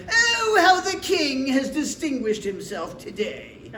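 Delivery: hammed-up, high, drawn-out scornful wail; bad tone. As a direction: mimic a wife's bad tone, way over the top. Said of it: "when she has a bad tone of voice".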